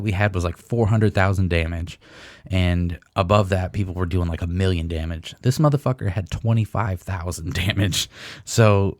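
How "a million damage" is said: ordinary delivery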